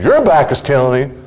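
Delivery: incredulous retort climbing very high; one-up the complaint, pitch soaring in outrage; mock indignant. Spouse's indignant one-upping retort, acted.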